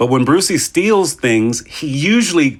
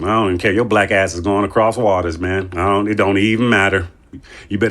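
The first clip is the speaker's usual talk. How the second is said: usual speaking voice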